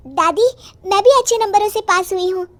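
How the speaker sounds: put-on high, loud girlish voice; young granddaughter. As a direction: announce it to grandma as an eager child. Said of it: "तभी बिंदु कहती है". (बच्ची की ऊँची आवाज़)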